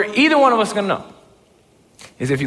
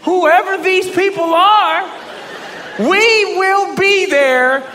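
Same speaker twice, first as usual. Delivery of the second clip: very high, drawn-out stalling voice; high, slow, improvised false confidence; bluffing agreement; 12 semitones higher, much slower than usual. Retold or imitated. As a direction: stretch the words out, pitched way up. Bluffing that a crew exists.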